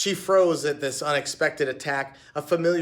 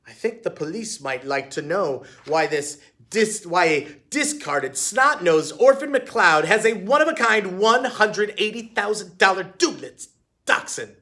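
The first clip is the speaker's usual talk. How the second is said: sneering; 5 semitones higher, 5 dB louder than usual. A narrator's reading. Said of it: "she sneered".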